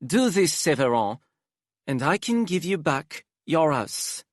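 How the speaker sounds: high, theatrical aristocrat; dramatic Hypnos voice. Acted. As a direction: flamboyant and weary, sighing exaggeratedly.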